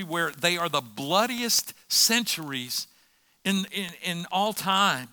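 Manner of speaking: impassioned preacher building a case; forceful preaching. Real, not acted. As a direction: drive each point home to the congregation.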